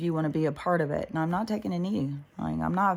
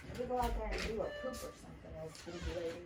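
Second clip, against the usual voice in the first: talking to the dog; 15 dB quieter, faster than usual. Real, not acted.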